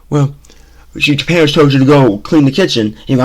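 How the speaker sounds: normal talking voice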